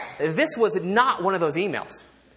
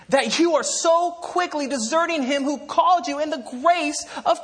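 high-pitched, emphatic indignation; intense outrage; 7 semitones higher than usual. Acted. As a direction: read it as Paul's astonished rebuke, driving hard. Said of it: "let me read how I think he wanted this to come across".

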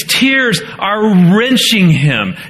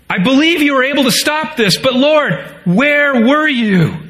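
reproachful; 6 semitones higher than usual. Acted. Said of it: "There's the blame".

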